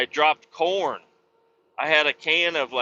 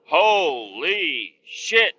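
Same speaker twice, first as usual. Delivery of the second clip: amazed exclaim; 4 semitones higher, 3 dB louder, much slower than usual. Real, not acted. Amazed at tasty burritos.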